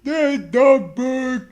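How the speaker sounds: drawn out and loud through laughter; laughing hard at the dumb book. Real, not acted. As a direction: laugh the words out slowly. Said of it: "What an idiot".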